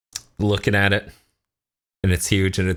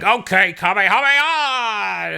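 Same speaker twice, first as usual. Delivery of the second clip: high, drawn-out attack yell; mock battle cry; 10 semitones higher, 3 dB louder, slower than usual. Acting out Goku firing his big attack, mock battle cry. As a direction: imitate an anime hero calling out his move, last word stretched. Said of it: "Then Goku's like".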